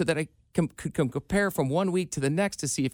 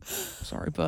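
high, soft pet voice; talking to a dog; 12 semitones higher, 8 dB quieter, slower than usual. Real, not acted. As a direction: coo it softly to the pet. Apologizing to the dog.

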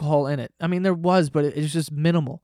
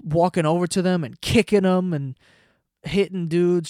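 ordinary delivery

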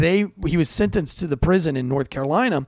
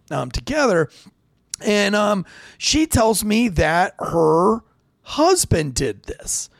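ordinary talk

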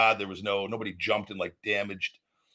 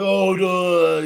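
high, loud, sarcastic duh; mock duh; 7 semitones higher, 8 dB louder than usual. Wrestler mocks the parking fee, loud high sarcastic duh. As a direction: play the obvious in a put-on voice. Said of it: "you're like".

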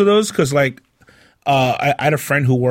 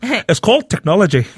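mock Scottish accent, a blend of shitty accents; jokey fake Scottish brogue; bad Scottish accent; 5 semitones higher than usual. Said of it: "What the hell kind of accent?".

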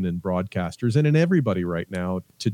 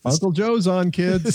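playful announcer; 9 semitones higher, 3 dB louder, slower than usual. Acted.

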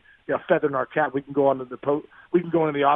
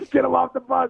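laughing mock yell; 10 semitones higher, 4 dB louder than usual. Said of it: "These guys are like".

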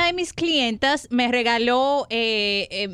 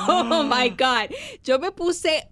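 laughing; 3 semitones higher, slower than usual. Real, not acted. Laughing retelling a shock.

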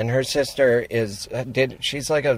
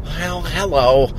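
slightly higher, drawn-out mom greeting; mom's phone hello; 5 semitones higher, 3 dB louder, slower than usual. Retold or imitated. Imitating her mom answering the phone, slow lilting hello. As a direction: stretch it out like mom picking up. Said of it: "I think my mom would go".

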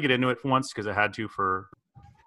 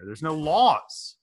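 mock exasperation; 4 semitones higher, much slower than usual.

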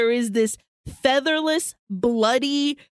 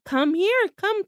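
coaxing call; 8 semitones higher than usual. Retold or imitated. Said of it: "she's telling it".

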